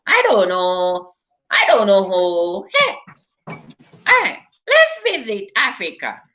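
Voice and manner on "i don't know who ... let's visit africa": ordinary talk, nothing marked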